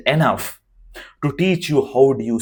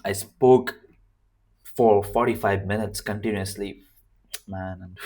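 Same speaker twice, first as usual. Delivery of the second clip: strained hoarse voice; tired hoarse voice; 3 semitones lower, 4 dB quieter than usual. Real, not acted. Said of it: "My voice is absolutely wrecked".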